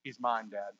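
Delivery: soft, low, tender Jesus to his Father; tender claim. Pretend. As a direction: hush it like a gentle promise. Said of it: "Jesus looks over to the Father and says".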